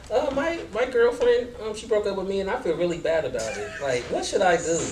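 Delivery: student voice. Acted. Plays a student asking teacher.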